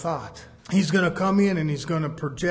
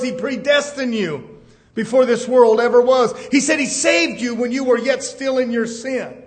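ordinary talk, nothing marked

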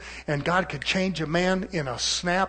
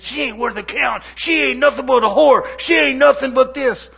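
higher, louder, contemptuous ranting about the wife; preacher acting out a husband insulting his wife, angry; mock angry husband; 8 semitones higher, 12 dB louder than usual. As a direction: spit it out like a bitter husband cursing. Preacher voices a cursing husband.

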